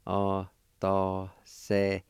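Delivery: Atasehete said slowly, syllable by syllable; teacher modelling Atasehete slowly; slow, careful. Shown as a demonstration. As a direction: draw out Atasehete for the learner. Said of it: "it's pronounced like this".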